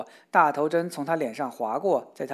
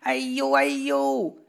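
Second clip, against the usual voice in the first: loud, rising ouch cry; yelp of pain; 9 semitones higher, 6 dB louder, slower than usual. A narrator's reading. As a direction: leap up yelping in pain. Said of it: "而且叫着". (疼得高声大叫哎呦)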